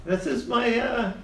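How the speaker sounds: high-pitched gnome voice; gnome character. Acted. Gnome explains his business partner, high gnome voice. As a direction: introduce him in a small high voice.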